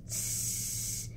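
S held long and hissed, tongue up; held s sound. Shown as a demonstration. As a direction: hiss out a long S. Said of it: "It looks like this".

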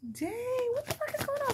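very high-pitched mock disbelief; stunned. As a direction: squeal it out in exaggerated shock. Acted stunned reaction to the card.

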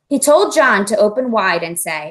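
usual speaking voice

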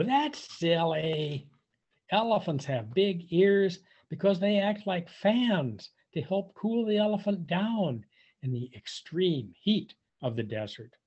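plain reading voice

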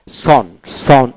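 সন said very slowly with a rounded অ; British son. Shown as a demonstration. Teacher models সন slowly, British style. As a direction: draw out সন with the British vowel. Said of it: "ব্রিটিশে সন উচ্চারিত হয়".

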